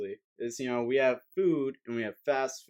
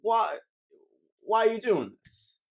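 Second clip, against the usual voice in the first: higher-pitched, halting mock worker; questioning fast-food worker; 6 semitones higher than usual. Burger King worker questioning a customer's big order, higher-pitched dismay. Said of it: "And they're like".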